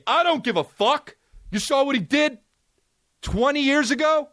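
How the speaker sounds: high-pitched, unforgiving demand; mock outraged mob. Host playing the cancel crowd wanting him gone, mock-indignant. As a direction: dig in and refuse to hear it.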